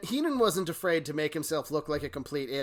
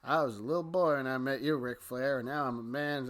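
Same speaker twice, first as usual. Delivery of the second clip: mild mock flat promo; 3 semitones lower, 6 dB quieter than usual. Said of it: "not very intense".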